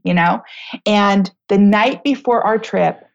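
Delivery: ordinary talk, nothing marked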